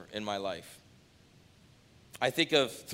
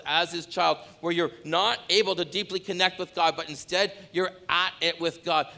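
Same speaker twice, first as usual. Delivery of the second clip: earnest pulpit appeal; impassioned preaching; 4 semitones higher than usual. Acted. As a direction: plead with the congregation to come back. Pleading call to repent.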